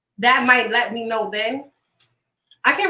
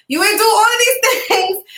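laughing; 10 semitones higher, 5 dB louder, slower than usual. Real, not acted. Laughs mid-sentence.

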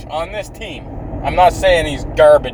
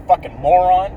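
ordinary talk, nothing marked

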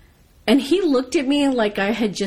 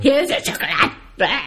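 gruff, higher mock waiter, tossing it over; a curt, dismissive server shoving a cup across; lightly hammed-up surly waiter; rude waiter; 5 semitones higher than usual. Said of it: "They didn't give a fuck".

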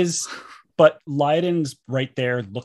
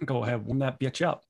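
normal talking voice

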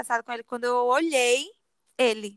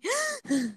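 sharp high sobbing gasp for breath; crying gasp; 10 semitones higher, 6 dB quieter than usual. Shown as a demonstration. To her friend, showing how she cried: sobbing gasp. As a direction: show the catch of breath in a hard cry. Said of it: "sabe quando você dá aquele choro que você fica assim".

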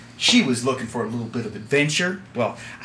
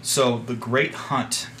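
grave storytelling; 2 semitones lower, 5 dB quieter than usual. Acted. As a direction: recount the dark history solemnly. Captain recounts grim history.

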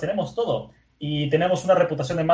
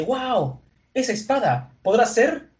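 high-pitched awe at a find; amazed wonder; 8 semitones higher, slower than usual. Retold or imitated. Swordswoman arriving and spotting the sword, amazed. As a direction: voice lifted high in dawning recognition.

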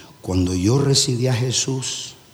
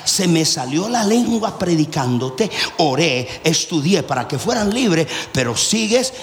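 prédica a plena voz; 11 semitones higher than usual. Real, not acted. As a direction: preach it hard to the congregation, building to a high shout. Preaches at full force. (predicando a plena voz, cada vez más agudo)

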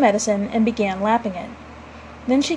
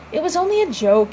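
mock roar; 6 semitones higher than usual. A narrator's reading. Father defends his prank to his wife, mildly roaring. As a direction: protest with a restrained roar. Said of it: "he roared".

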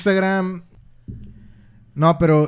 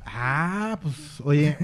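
drowsy, nodding off; sleepy; 7 dB quieter, much slower than usual. Real, not acted. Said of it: "me estoy quedando dormido".

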